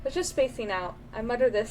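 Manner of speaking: ordinary talk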